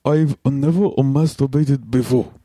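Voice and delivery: put-on slow Japanese accent read; drawn-out put-on Japanese accent.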